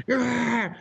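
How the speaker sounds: high drawn-out comic cry; mock terrified scream. Storyteller who wouldn't let go of the dolphin, mock scream. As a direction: cry out, clinging on and unable to let go. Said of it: "I'm scared for my life".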